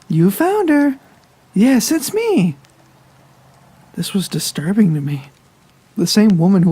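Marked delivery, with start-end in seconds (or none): 0.1-2.5 high-pitched, louder woman's character voice; high, gushing welcome; delighted caregiver. Acted. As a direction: greet a long-awaited visitor, pitched way up. Caregiver confirms who she is.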